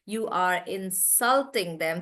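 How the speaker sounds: insulting with SALT stressed, said slowly; slow demo, weight on the second syllable of insulting. Shown as a demonstration. Teacher showing the verb to students, stress on SALT in insulting. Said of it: "So then I stress on salt".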